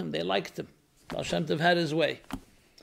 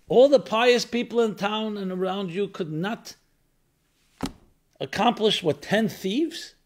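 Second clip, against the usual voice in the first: astonished question; 8 semitones higher, 4 dB louder than usual. Acted. Astonished followers question rebbe.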